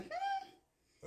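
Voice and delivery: high, soft puzzled hum; acting out being thrown off; mock bewilderment. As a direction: hum it high and small, as if stumped. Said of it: "we gonna be stuck on stupid".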